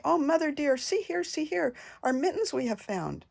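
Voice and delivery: little kittens showing off their found mittens; kittens crying out. A narrator's reading. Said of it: "and they began to cry".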